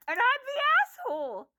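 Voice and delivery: high and mocking; incredulous sarcastic jab; sarcastic indignation. Real, not acted. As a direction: throw it up high, dripping sarcasm.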